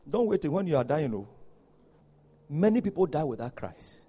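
normal talking voice

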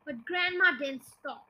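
ordinary delivery, nothing marked

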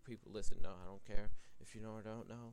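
loud call to listeners. Real, not acted. Podcaster calling out to listeners, loud. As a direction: raise the voice to grab the audience.

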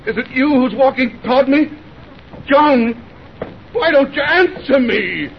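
high, frightened calling out; mounting terror. Acted. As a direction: call into the dark, close to panic. Terrified in dark, calling for wife.

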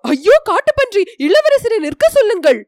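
high, loud cry of alarm; panicked cry. A narrator's reading. Companion spots a wild boar and calls to stop the prince, panicked cry. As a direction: shout a frightened warning. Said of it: "முகத்தில் பீதியின் அறிகுறி".

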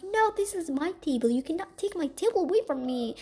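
girl claiming the table, mock whiny; mock whiny insistence. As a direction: whine it like a sulky brat. Girl insists, starts screaming.

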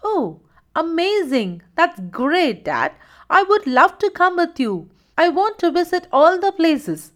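put-on high-pitched, thrilled boy's exclamations; excited. A narrator's reading. Simon tells Dad he would love to come along, excited. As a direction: gush with eager delight, voice lifted high. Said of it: "Simon was excited".

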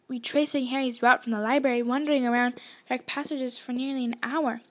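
usual speaking voice

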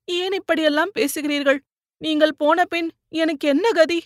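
high, tearful pleading question; a frightened wife begging him to stop; anguished. A narrator's reading. Wife begging her husband not to talk of dying, high and tearful.

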